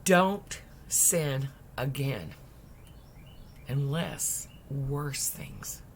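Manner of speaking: grave warning. Retold or imitated. Jesus quietly warns the healed man, slow and hushed. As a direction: lean close and warn him.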